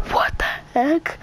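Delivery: breathy whisper of shock; whispered disbelief. Real, not acted. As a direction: whisper it, stunned.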